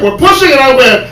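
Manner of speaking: ordinary talk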